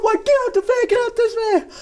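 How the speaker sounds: high, loud frantic pleading; panicked scream. Retold or imitated. The beaten attacker screaming to get out, a high panicked shriek. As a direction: scream to be let go.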